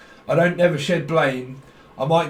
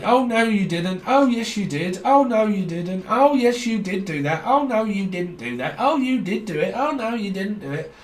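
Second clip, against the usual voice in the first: lightly hammed, higher oh no you didn't; mock argument; 5 semitones higher than usual. Psychic plays both sides of an argument, mild mock bickering. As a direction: playground squabble, half acted.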